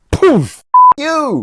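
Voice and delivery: high, explosive punch noise; hammed-up high retort; mock fighting back. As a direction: burst out as if landing a punch. Voicing Sebastian hitting back.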